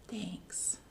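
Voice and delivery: soft breathy whisper of thanks; a touched bear barely speaking. Acted. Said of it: "And the bear says".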